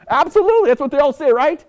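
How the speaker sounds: high, loud and emphatic; loud, high knowing agreement. Real, not acted. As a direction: hammer it home to the crowd.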